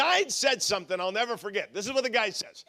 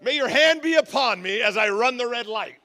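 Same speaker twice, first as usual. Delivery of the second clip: mock prayer; 2 semitones higher, 5 dB louder than usual. Speeding driver praying to the Lord, mock-pious prayer. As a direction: pray it with mock piety, slowing down. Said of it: "saying my prayer".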